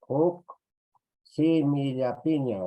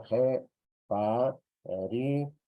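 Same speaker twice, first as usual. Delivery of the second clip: "jeparicui" slowly broken into syllables; teacher's very slow model of "jeparicui"; syllable-by-syllable demo; much slower than usual. Shown as a demonstration. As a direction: sound out "jeparicui" one drawn-out syllable at a time. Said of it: "Y está el sonido".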